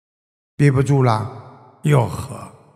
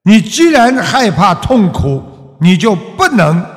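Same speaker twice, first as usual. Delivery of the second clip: ordinary delivery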